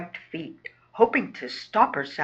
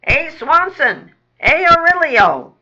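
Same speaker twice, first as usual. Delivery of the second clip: loud, raised holler; bellowing; 9 semitones higher, 11 dB louder than usual. Acted. Fat rat summons his two pals, bellowing. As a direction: bellow the names across the sewer. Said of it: "bellows a large fat one".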